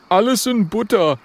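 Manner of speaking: high, punchy character voice; high-pitched bravado; cocky little dragon. Acted. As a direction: brush it off as a tough little dragon.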